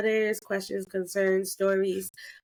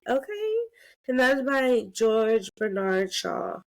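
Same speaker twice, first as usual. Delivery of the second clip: ordinary delivery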